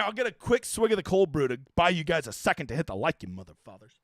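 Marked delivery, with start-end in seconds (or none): 3.2-3.9 muttered jab. Real, not acted. Playful jab at viewers.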